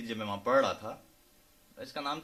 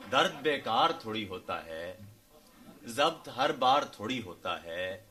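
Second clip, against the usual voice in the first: measured ghazal recitation, phrase by phrase; poetry recital; 5 dB louder, slower than usual. Acted. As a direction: recite couplets to an audience, unhurried and declaimed.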